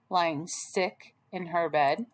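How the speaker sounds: plain reading voice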